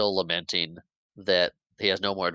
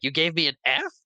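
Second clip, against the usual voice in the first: higher, incredulous mock protest; indignant; 8 semitones higher than usual. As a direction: act out a kid's offended disbelief. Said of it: "And is all indignant".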